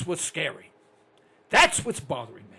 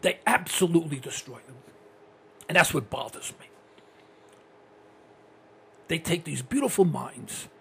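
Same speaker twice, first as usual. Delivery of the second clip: normal talking voice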